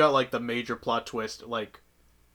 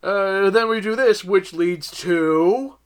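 slow, loud lecturing teacher; teacher lecture voice; 6 semitones higher, 7 dB louder, much slower than usual. Retold or imitated. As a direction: drag it out like a lecturer. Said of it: "just going".

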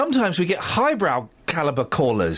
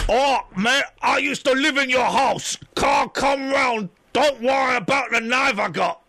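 loud, higher rough bloke, words stretched out; menacing doorstep visitor; 5 semitones higher, 3 dB louder, slower than usual. Acted. Visitor with a knife asks to come round, loud dodgy bloke voice. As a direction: call it out like a dodgy stranger wanting in.

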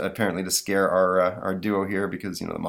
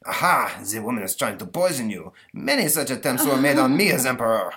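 put-on French accent; 4 semitones higher, 3 dB louder than usual. Napoleon warns the boy.

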